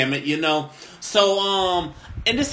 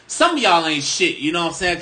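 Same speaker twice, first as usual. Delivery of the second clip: ordinary delivery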